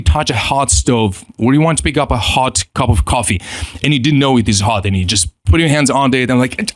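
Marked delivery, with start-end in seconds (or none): none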